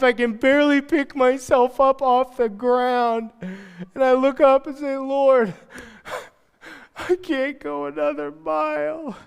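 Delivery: high, slow, worn-out groan; exhausted plea. Retold or imitated. Preacher to God, slow worn-out begging. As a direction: drag it out like you can't stand up. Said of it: "tired, exhausted moments".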